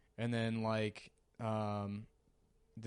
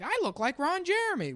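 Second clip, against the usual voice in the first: much higher, proud squeaky boast; high-pitched caricature; 5 dB louder than usual. Retold or imitated. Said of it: "He's like".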